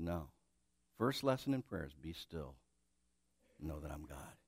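low, soft and firm; calm voice of the Lord. Acted. God answering the impatient preacher, low and calm. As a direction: settle him down gently but firmly. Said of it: "And the Lord said".

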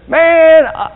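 high, loud teasing jab at a friend; jokey mock-sermon teasing; teasing joke. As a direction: rib him with a grin. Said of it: "I looked at him and I said".

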